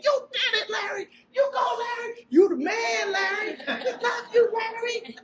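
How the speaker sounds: high, loud, tearful shouting of a cheering crowd. Retold or imitated. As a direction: yell joyful encouragement at the boy. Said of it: "cheering and clapping".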